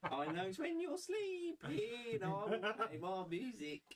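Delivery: put-on high, eerie little-girl voice; creepy child voice.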